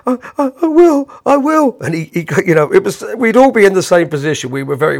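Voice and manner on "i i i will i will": high, stammering nervous promise; flustered stammer. Retold or imitated. Rattled Bob promising the host, high nervous stammer. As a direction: squeak out the promise in a panic. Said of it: "Bob went".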